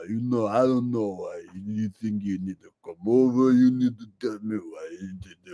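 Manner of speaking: low, mumbled Rocky voice; Stallone impression. Comedian answers a fan's request, low mumbling Rocky voice. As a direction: slur it like Rocky Balboa. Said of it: "Can we get a Sylvester Stallone impression?".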